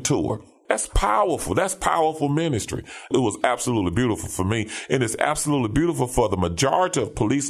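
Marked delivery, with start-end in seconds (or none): 0.7-1.5 pitched way up with fervor; emphatic exclamation. Real, not acted. Preacher marveling at police ministry, emphatic lift. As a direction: preach it, really lift the word powerful.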